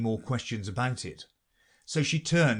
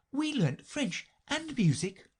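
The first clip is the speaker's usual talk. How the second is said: put-on higher, puzzled girl's question; curious young Alice; 14 semitones higher than usual. Alice questioning the Mock Turtle, puzzled. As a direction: ask it like a curious little girl.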